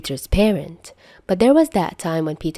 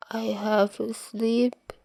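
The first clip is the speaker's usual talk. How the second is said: put-on sleepy kid; 5 semitones higher, 7 dB quieter, slower than usual. Drugged Peter whining quietly.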